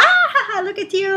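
high, loud mocking laughter. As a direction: tease like a schoolkid. Classmates mocking her modeling pictures.

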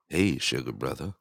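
low, flirty come-on voice; sexy voice. Shown as a demonstration. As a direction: purr it like a flirt. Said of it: "throw a little sexy voice out".

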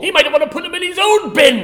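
high, loud accusation; hammed-up angry complaint; mock outrage. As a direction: shout the accusation in a pretend row. Mock quarrel with the doctor.

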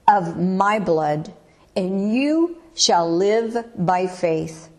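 ordinary talk

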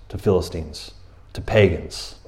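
ordinary talk, nothing marked

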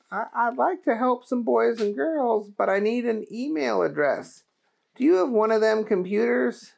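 earnest, eager to help; Booger voice. Acted.

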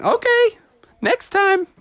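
put-on high kid-voice reply; family replying. Family answers dad's complaint, chirpy agreement. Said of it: "And they're like".

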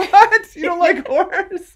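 high, loud mock disbelief; mock outraged. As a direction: squeal it in playful outrage. Mock outrage after "moving on.

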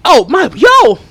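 excited shout of surprise. Real, not acted. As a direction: yell out a happy greeting. Greets caller arriving live.

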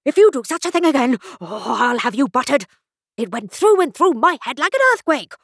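high, agitated scolding; hammed-up royal outrage; outraged king. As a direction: scold as if your head is still ringing. Deafened king scolds messenger.

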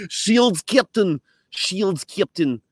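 high-pitched mock Russian accent; Chekov. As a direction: call out urgently in a hammed-up Russian accent. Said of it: "that Chekhov had in Star Trek".

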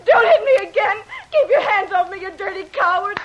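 high, loud, tearful plea; crying out in fear. Acted. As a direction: shout through tears. Woman being struck in a quarrel.

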